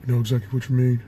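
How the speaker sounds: low, quiet big-man mumble; Shaq. Acted. Co-host agreeing with Barkley about Westbrook, low soft Shaq voice. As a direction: drop it low and soft, short phrases with pauses. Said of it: "And then Shaq".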